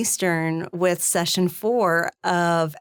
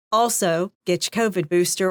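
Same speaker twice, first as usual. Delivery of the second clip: ordinary delivery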